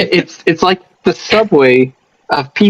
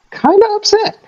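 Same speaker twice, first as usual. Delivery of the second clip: laughing. Real, not acted. Laughing admission.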